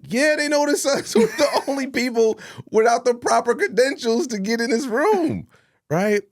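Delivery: high, loud, laughing through it; laughing disbelief. Retold or imitated. Admitting to the host they were spotted, laughing. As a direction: crack up at how obvious you are. Caught in the green room.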